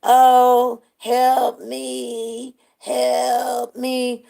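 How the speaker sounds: drawn-out, level wail for help; mock cry for help. Storyteller imitating intoxicated woman on the dance floor, mock plea for help. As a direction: imitate a drunk woman's long, droning plea. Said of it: "she's like".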